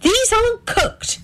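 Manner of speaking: high, accusing wife; high pitched accusation; accusingly. A narrator's reading. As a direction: hold up the evidence and blame him. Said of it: "she said accusingly".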